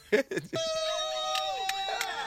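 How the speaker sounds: shrieking burst of laughter. Real, not acted. Hosts cracking up at the joke, shrieking laugh. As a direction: let out a high squealing laugh. Loud high laugh over music.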